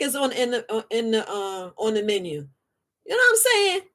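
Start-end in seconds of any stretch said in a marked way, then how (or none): 3.1-3.8 playful high aside. Real, not acted. Aside to audience.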